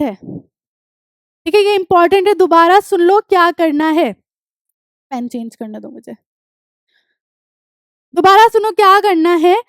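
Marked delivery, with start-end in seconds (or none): 5.1-6.1 low, very soft practical aside; quiet aside. Real, not acted. Teacher pauses to change her pen, quiet aside. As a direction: say it under the lesson, almost to yourself.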